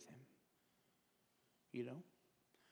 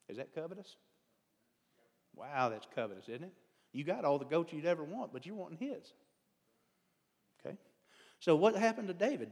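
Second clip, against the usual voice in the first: usual speaking voice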